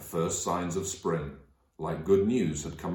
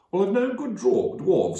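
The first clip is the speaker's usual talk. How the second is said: much higher homely wife's voice; Missus Beaver; 12 semitones higher, 4 dB louder than usual. Acted. Missus Beaver puts in a word for dwarves, high homely voice. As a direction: pipe up as the beaver wife.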